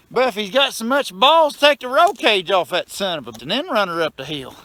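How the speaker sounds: scornful rant at a show-off; heated rant. Real, not acted. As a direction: raise it, daring him to try. Ranting at showoff on Facebook.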